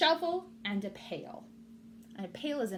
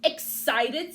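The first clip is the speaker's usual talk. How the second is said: scoffing; 4 semitones higher, 9 dB louder than usual. Acted. Rabbit scoffs.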